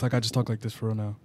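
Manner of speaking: put-on deep voice. Claiming it is his real voice, deepened. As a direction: drop it down and keep it understated. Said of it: "this is my real voice".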